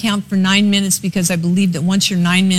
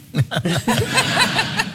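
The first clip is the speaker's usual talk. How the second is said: high laugh; 9 semitones higher, 3 dB quieter than usual. Real, not acted. Laughs at Andy's description.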